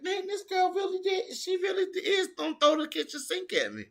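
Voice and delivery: very high put-on voice, mock exasperated; imitating Casey. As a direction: play Casey amazed at the flurry. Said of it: "Casey O'Neill was looking at her like".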